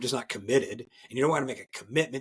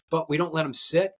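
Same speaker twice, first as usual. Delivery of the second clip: ordinary talk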